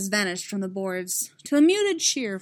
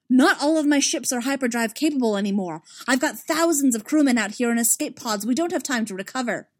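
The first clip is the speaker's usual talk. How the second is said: furious protest; 5 semitones higher, 7 dB louder than usual. Acted. Emil protests the order.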